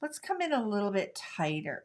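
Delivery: usual speaking voice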